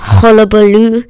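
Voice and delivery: usual speaking voice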